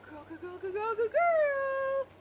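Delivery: high, stretched dog praise; squeaky praising a dog. Real, not acted.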